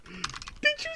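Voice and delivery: high squeaky incredulous yelp; outraged disbelief. Real, not acted. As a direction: blurt it out in shocked disbelief. Mock outrage at chat.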